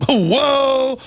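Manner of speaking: high, drawn-out mock shock; mock alarm. Host mimicking outrage over a Hitler teapot, mock alarm. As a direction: feign horror at something trivial.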